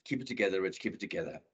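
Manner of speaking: low, soft self-talk; hushed, low inner voice; self-steadying. Retold or imitated. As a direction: tell yourself quietly to hold on. Slumped at wheel, talking to himself.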